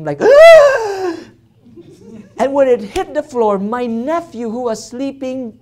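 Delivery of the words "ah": loud high-pitched scream; sudden high scream acted out; inside scream. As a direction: let out a piercing "Ah!". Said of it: "like, scream, the inside scream".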